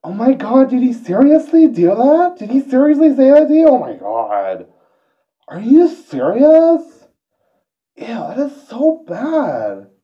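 put-on very high, loud gushing valley girl. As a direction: squeal it like a shocked valley girl gossiping. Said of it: "valley girl is kinda like".

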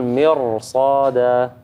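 مرصادا said slowly with a full, heavy ر; heavy raa. Shown as a demonstration. Teacher demonstrating the heavy raa before the saad, slow and deliberate. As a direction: thicken the ر of مرصادا to match the ص. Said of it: "فبنفخمها".

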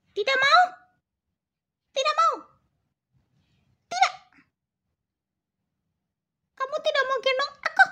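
very high, insistent cat voice; stubborn cat. Pretend. Cat refuses Mami's scolding, high stubborn cat voice. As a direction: play a sulky little cat refusing.

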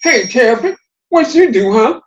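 high, loud taunting voice; teasing Bear. Acted. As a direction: poke at Terrapin loudly.

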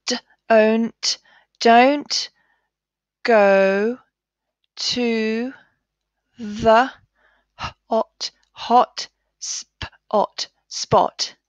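each word split and sounded out slowly; word by word reading. Shown as a demonstration. Teacher reading a sentence slowly with pupils. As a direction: sound out every word in turn. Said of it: "let's read it together".

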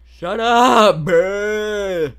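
high, very loud shut-down; exasperated full-volume play shout; pretend angry yell. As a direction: yell it high in mock outrage. Pretend quarrel peaks.

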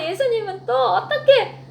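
normal talking voice

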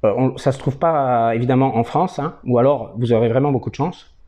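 talking around pipe stem; pipe in mouth. Real, not acted. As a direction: lips clenched on pipe. Seen 0: Pipe stem held between lips throughout, briefly removed near 6.6s.

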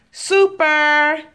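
high, loud cheer; excited praise. Real, not acted. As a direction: cheer her on. Praising a child at play.

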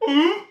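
high, loud retold ooh of shock; mock startled ooh. Retelling how his mouth reacted to the fish, mock ooh. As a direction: imitate the mouth's surprised ooh. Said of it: "My mouth just went".

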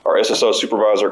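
usual speaking voice

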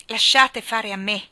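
loud, slow, full of importance; self-important. A narrator's reading. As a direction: take charge grandly, drawing it out. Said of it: "con aria di importanza". (con aria d'importanza)

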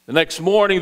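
ordinary talk

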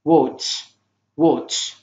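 "Watch" ending in a clear "ch"; TCH as "ch". Shown as a demonstration. Teacher showing TCH in "Watch" pronounced as "ch". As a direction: say "Watch" alone, finishing on "ch". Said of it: "تي سي اتش منطقة تش".

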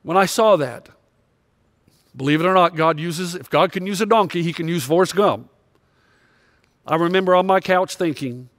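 ordinary delivery

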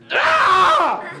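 sudden loud high yelp; startled shout. Real, not acted. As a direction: jump back with a yelp. Startled cry at comet.

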